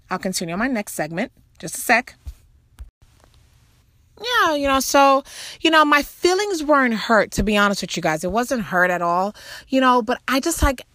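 usual speaking voice

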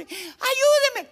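high, drawn-out loud plea; shouting for help. Acted. Drowning man to his compadre, shouting for help. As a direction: scream for rescue, hammed-up. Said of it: "él empezó a gritar".

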